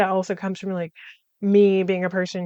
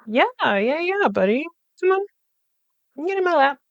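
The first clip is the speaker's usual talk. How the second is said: coaxing a pet; 7 semitones higher than usual. Real, not acted. Vlogger calls the cat into their lap, higher coaxing voice. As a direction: sweet-talk the cat in a high voice.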